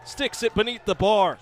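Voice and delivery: shouted high and loud as the shot goes in; excited goal call. Real, not acted. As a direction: yell the goal like the crowd just erupted. Goal call by play-by-play announcer.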